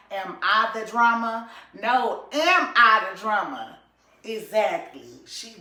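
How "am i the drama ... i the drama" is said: louder, higher, drawn-out am I the drama; loud mock-dramatic quote, last one slower. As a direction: belt out the famous line. Quoting [name]'s famous line.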